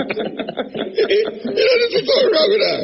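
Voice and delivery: high laughing disbelief; real laughter through the words. Real, not acted. Laughing at guest's choice.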